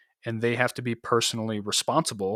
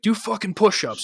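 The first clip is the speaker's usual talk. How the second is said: barked order pitched higher; angry order; 9 semitones higher than usual. Retold or imitated. Platoon sergeant orders the soldier down, mock-angry bark. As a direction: retell the sergeant's furious command, pitched up.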